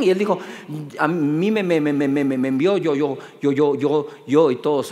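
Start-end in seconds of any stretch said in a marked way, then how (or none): 1.0-4.5 lower, stumbling on every word; stuttering Moses. Retold or imitated. Moses stutters his answer to Pharaoh, lower, stammering. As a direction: stammer it out, stuck on each syllable. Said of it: "él era tartamudo".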